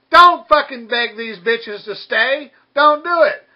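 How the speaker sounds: ordinary delivery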